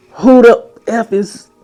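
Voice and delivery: put-on high, slow shrieking girlfriend; mock furious girlfriend. Ex screaming at him after hitting him awake. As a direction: drag it out like an enraged ex. Ex voiced, waking him angrily.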